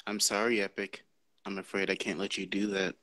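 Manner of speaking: low, soft computer voice; calm computer voice. Pretend. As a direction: quietly refuse like HAL. HAL impression, soft and low.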